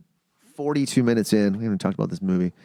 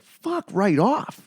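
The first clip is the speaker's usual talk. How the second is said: very slow, much higher disbelief; 10 semitones higher, much slower than usual. Retold or imitated. Reacting to the sixty-one-year-old, slow stunned disbelief. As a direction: drag it out in shock. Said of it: "looking at them both like".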